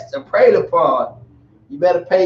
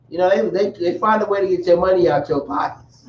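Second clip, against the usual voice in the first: ordinary talk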